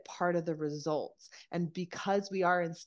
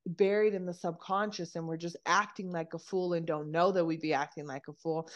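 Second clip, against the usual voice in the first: ordinary delivery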